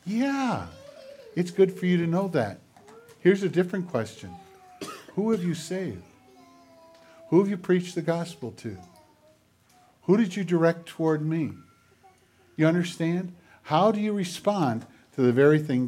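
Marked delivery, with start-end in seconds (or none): 0.0-11.5 soft, hushed voice of God, still voiced; retold whisper. Preacher voicing God questioning a believer, mock whisper. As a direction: imitate a gentle whisper, questions spaced by pauses. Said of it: "And he whispers, goes".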